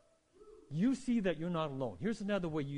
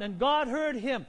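ordinary talk, nothing marked

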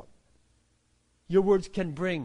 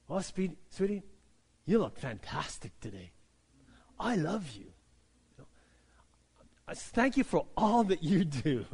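sweet talk to wife. Acted. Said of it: "I'm saying that right to my wife right now".

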